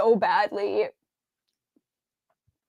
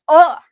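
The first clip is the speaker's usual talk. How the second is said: angry groan; 3 semitones higher, 10 dB louder than usual. Real, not acted. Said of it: "Oh, I'm getting mad".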